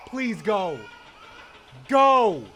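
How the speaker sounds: escalating from loud plea to high scream; desperate screaming. Real, not acted. Player begging Foxy to go, desperate screaming. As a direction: build to a full-out urging scream.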